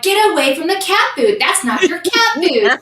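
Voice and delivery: high scolding of an animal; retold yelling. Retelling yelling at the raccoon through the door, high and scolding. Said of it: "yelling at it through the door".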